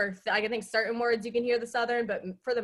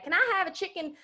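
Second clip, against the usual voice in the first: pitched-up impression of mom's Southern twang; mom's Southern accent; 5 semitones higher than usual. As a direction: order like a gracious Southern mama, drawling. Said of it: "every time she goes to Chick-fil-A".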